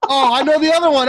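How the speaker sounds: fast, loud eager blurt; can't hold it in, rushing to answer; excited blurt. Real, not acted. Eager to name the movie.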